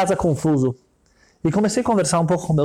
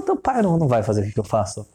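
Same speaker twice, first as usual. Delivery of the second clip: low, condescending rich man; rich man's voice; 8 semitones lower, faster than usual. Retold or imitated. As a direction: talk down to the child, low and sure. Rich man answers child.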